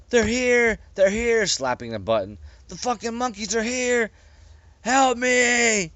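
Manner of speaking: high, loud frantic shouting; panicked yell. A narrator's reading. Captain shouting into the speaker, high and frantic. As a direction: scream for rescue. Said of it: "yelled into the speaker".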